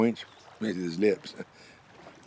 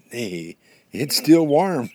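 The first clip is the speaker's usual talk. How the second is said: high, loud, drawn-out cry of discovery; excited discovery; 7 semitones higher, 11 dB louder, much slower than usual. Acted. Porcupine finds a fresh buffalo chip, excited. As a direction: exclaim it slowly, pitched up and loud.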